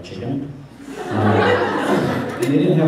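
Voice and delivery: ordinary delivery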